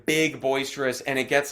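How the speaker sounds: ordinary delivery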